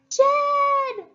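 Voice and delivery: very high, loud scream of a name; harp screaming. Acted. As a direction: shriek the name in panic. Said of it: "the harp screamed".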